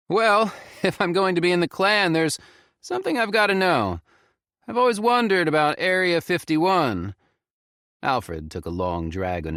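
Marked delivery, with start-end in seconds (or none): 0.1-7.1 high, loud playful joking tone with a laugh. Acted. Felix jokes to grandpa Alfred at the wedding, playful and high. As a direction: tease him lightly. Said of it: "I tried to joke back".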